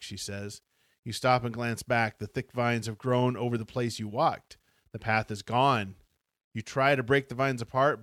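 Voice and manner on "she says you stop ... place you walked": ordinary delivery, nothing marked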